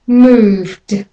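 Moved, V straight into D, no vowel between; moved with a final d. Shown as a demonstration. Teacher models moved for learners. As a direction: buzz the V into the D in Moved. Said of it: "And again".

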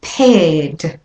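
Paired without R, air vowel then D; British or Australian accent: drop the R in Paired. Shown as a demonstration. Teacher models British and Australian paired. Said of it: "And again".

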